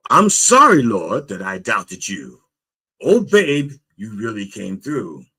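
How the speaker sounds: dramatic prayer with punched openings; exclaimed apology to God. Acted. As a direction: cry out the apology, then calm. Acting the man's prayer again.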